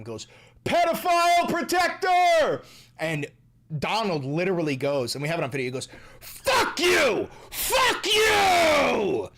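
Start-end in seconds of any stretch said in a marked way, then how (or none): 0.6-2.6 high, drawn-out heckling shout; imitated heckler's yell. Auto worker heckling the president on a plant visit, yelled. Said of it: "auto plant workers yelled at him". 6.2-9.3 high, full-volume mock retort; imitated angry shout. President answering an auto worker's heckle, shouted.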